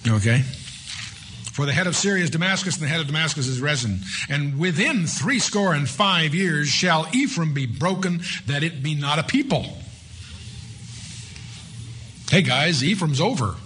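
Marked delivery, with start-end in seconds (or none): 1.5-9.8 raised, measured reading of a Bible verse; scripture reading. Acted. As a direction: read it out like solemn scripture.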